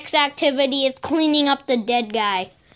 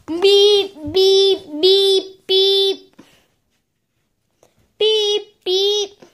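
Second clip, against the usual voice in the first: mock beeping; 4 semitones higher, 4 dB louder, much slower than usual. Imitating a beeping device.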